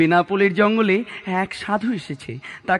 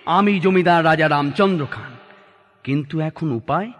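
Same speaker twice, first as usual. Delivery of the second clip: ordinary delivery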